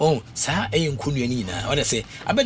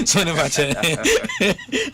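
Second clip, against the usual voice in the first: laughing; 5 semitones higher, much slower than usual. Real, not acted. Laughs at his own point.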